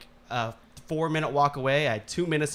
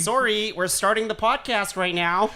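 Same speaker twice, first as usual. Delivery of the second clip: higher-pitched apologetic Canadian; hammed-up Canadian host; put-on Canadian accent; 6 semitones higher than usual. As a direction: announce it as a sorry Canadian, pitched up. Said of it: "I'm gonna drop the accent".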